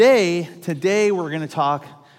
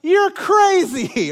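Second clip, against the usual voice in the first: much higher, slow drawn-out alarm; stunned disbelief; 13 semitones higher, 5 dB louder, much slower than usual. Retold or imitated. Dad watching son on tall ramp, stunned and drawn out. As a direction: stretch it out in shock. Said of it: "And I'm like".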